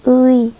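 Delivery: "ui" said as u gliding into i; vowel demo. Shown as a demonstration. Teacher repeating the vowel for the class, clear glide. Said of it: "é basicamente o som do U, mas o I".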